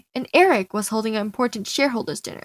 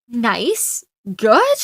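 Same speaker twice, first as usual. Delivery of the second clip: unimpressed questioning echo, pitch rising on each word; incredulous echo. Acted. Friend doubting a lukewarm kiss report, incredulous echo. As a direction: throw the words back as doubtful questions.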